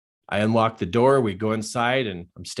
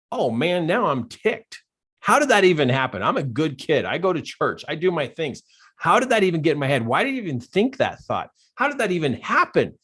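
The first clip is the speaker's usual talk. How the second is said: higher, indignant retold rant; ticked off; 6 semitones higher than usual. Man building Legos berates his own thoughts, ticked off. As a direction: act out exasperated questions fired at yourself. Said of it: "I was ticked".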